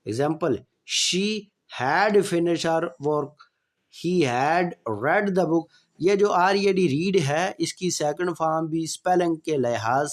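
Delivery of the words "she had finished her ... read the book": slow, clear dictation pace; slow classroom example sentence; slow example read. Shown as a demonstration. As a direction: spell it out slowly for students. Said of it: "teacher reading example sentence".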